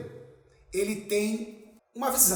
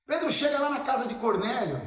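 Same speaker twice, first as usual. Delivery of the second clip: ordinary talk, nothing marked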